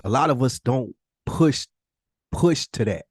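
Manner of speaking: usual speaking voice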